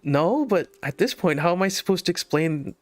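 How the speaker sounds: high, flustered pleading; mock nervous protest. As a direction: imitate yourself helplessly trying to explain. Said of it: "I just nervous smile and laugh".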